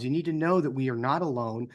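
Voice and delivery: normal talking voice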